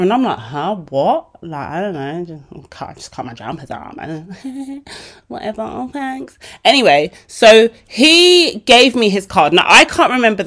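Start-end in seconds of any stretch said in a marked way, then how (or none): 0.4-6.4 a much softer, embarrassed mumble; shy mumble. Retold or imitated. Shy girl answering an admiring stranger, mumbling softly. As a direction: shrink into the words like a shy teen.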